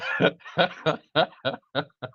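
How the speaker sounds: high, helpless laughing fit; uncontrolled laughter. Real, not acted. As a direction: burst out laughing for real. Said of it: "I just started laughing".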